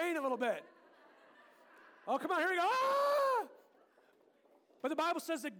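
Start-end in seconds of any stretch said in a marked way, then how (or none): 2.6-3.5 loud high playful shout; hammed-up storm cry; storm yell. Storm enacted with volunteers.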